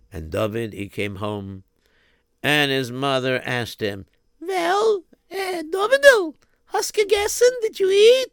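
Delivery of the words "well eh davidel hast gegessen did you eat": high, sweet mother's voice; a Yiddish mama asking gently. Acted.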